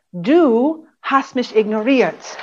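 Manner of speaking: Du pressed first, slow and accusing; stress on du. Shown as a demonstration. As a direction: lean on Du as a blame. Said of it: "versteckte Du-Botschaften".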